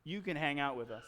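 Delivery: low, soft, scheming voice; sinister agreement. Retold or imitated. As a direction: agree with quiet, sinister intent. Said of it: "we all got this sinister look".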